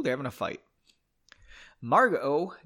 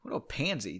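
usual speaking voice

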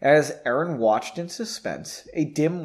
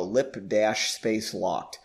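ordinary delivery

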